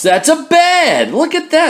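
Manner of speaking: squealing with delight at a cat bed; excited gushing. Acted. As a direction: gush over it like a proud owner. Reader voices excited gushing human.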